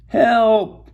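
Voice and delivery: high, loud cry from far away; distant high-pitched shouting. A narrator's reading. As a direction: call for help from a distance. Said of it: "shouted a faraway voice".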